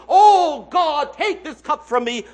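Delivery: loud anguished cry to God. Acted. Jesus crying out to God in Gethsemane, loud anguish.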